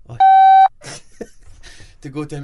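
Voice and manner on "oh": ordinary talk, nothing marked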